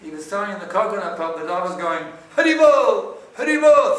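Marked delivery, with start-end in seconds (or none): 2.3-4.0 high, loud bark in words; barking dog. Acted. Dog in the story chanting to the Lord, barking. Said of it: "sort of barking".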